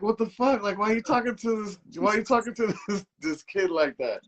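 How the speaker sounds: high, incredulous what-the-fuck; indignant retort. Retold or imitated. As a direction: call her out in disbelief. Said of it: "And I'm like".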